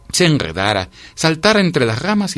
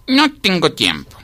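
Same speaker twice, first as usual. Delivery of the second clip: high, drawn-out excuse; self-important ant, stretching the words; busy brush-off; 9 semitones higher, slower than usual. A narrator's reading. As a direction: put the listener off in a high, slow singsong. Busy ant declining an invitation.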